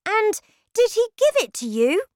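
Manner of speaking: put-on high, loud, eager child; excited boy. A narrator's reading. Boy asks if grandmother got the address, excited high voice. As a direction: ask excitedly as a little boy.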